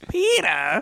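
high, loud cartoon wife; Lois Griffin. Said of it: "then Lois is like".

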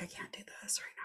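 almost voiceless, very soft whisper. Retold or imitated. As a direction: breathe it out, overwhelmed. Retold overwhelmed reaction.

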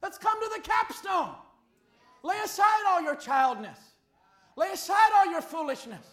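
loud, rising preacher's cry to the flock; fiery preaching. Acted. Pastor pleading with the congregation, loud fiery preaching. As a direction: preach it out, pushing hard on every phrase.

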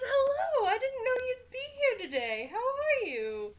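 high, fast, sugary greeting; high bubbly Skyla voice. Acted. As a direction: gush with feigned delight. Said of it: "she goes".

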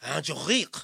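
low, throaty French R in Angelique; mock-French name with a rising end; French pronunciation. Shown as a demonstration. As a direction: overdo the French accent on Angelique. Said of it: "That's how you say it?".

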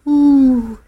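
high ghostly wooo of wind; eerie wind howl. Retold or imitated. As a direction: moan like wind through rocks. Imitating wind in mountains.

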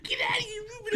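laughing wail. Pretend. Mock waitress tossing Ruben out, high laughing wail. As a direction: squeal it through laughter, near tears. Echoing the line with laughing cry.

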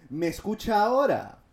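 loud, high retold yell; retold shouting. Agent checks if caller can hear, shouting. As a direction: shout it down the line. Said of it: "empiezo a gritar".

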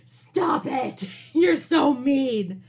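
high, loud, laughing shout at the professor; mock shout. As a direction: yell it out, half laughing, like a protest. Retold outburst at professor.

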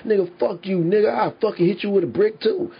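the abuser snapping back at the rescuer, mock angry; mock angry comeback. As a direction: toss back a high, cocky threat. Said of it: "You know what the fuck he gonna say?".

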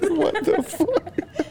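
high slow laughing retold disbelief. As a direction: squeal it through laughter. Laughing retelling reaction.

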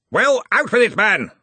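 roared high and loud, "Well, out with it, man!"; roaring. A narrator's reading. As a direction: bellow it at the hesitant wizard. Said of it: "roared Ridcully".

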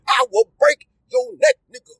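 high, pinched cartoon threat. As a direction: squeak out the threat like the cartoon mouse. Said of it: "[name] turned into Pinky".

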